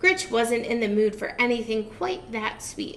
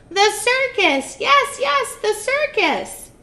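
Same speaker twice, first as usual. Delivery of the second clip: high, loud, thrilled witch voice; excited witch; 11 semitones higher, 7 dB louder than usual. Acted. Witch thrilled at her circus idea. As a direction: squeal it with glee.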